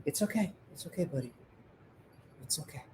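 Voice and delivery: soft, hushed reassurance; soothing a scared dog. Real, not acted. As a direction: whisper comfort to a scared pet. Calming scared pet.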